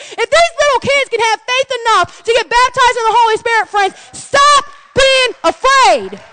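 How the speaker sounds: high, driving preacher delivery; fervent preaching. Real, not acted. Preacher urging the congregation to pray in the Spirit, fervent. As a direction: preach it with full fervour.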